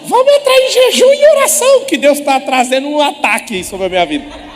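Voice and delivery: mock panicked youth. Preacher mocking a frantic youth to his pastor: high, loud mock panic. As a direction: shriek like a panicky kid begging the pastor.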